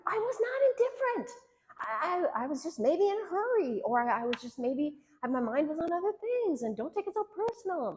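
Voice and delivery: high-pitched indignant self-defense; defensive arguing. Acted. Wife arguing with her husband over her tone, defensive. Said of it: "And I could argue".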